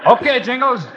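high shout to the shore; calling out. Acted. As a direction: yell over the current. Calling from the water.

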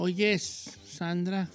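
slow, low and soft, stretching the name out; changed flirty voice. Pretend. Host changes his voice to call Sandra, slow and soft. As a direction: drop into a lightly put-on crooner voice. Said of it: "¿por qué cambia la voz, señor?".

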